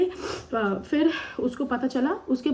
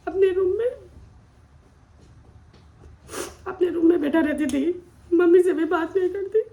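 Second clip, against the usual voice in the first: higher, strained, on the edge of crying; tearful distress; 5 semitones higher than usual. Real, not acted. Woman recounting how she shut out her whole family, tearful. Recounting painful isolation, voice rising with emotion.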